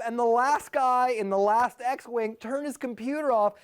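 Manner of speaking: ordinary talk, nothing marked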